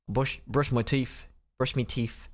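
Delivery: playful put-on Cockney; Cockney accent. Joking about brushing teeth while reading aloud, mock Cockney accent. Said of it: "I was doing a Cockney accent".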